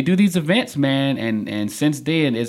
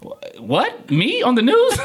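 retold disbelief; 13 semitones higher, 4 dB louder, slower than usual. Songwriter hearing the news wants him, amazed and laughing. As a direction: stammer into a high, laughing astonishment.

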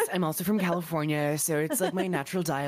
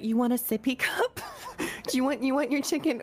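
put-on talking to a toddler; 8 semitones higher than usual.

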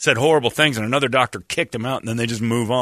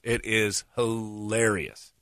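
sarcastic; 3 semitones lower, 7 dB quieter, much slower than usual. Real, not acted. Sarcastic praise.

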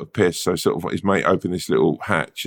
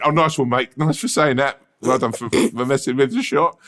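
a touch of mock-sarcastic praise, pitched higher; lightly sarcastic well done, higher; 5 semitones higher than usual. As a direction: needle him with fake thanks. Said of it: "I sort of went".

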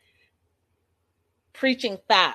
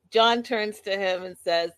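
ordinary talk

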